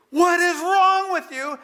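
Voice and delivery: high, loud, slow, drawn out in disbelief; exasperated outburst. Retold or imitated. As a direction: let the frustration burst out slowly. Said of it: "I wanted to just say".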